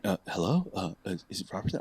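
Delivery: soft phone voice. Retold or imitated. [name] on the phone, quietly asking for [name]. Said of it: "the phone rings again".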